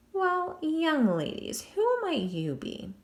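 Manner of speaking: put-on drawn-out, courtly English accent; slow English charm. Jack greeting the girls at his cell window, slow English charm. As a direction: charm them slowly in English.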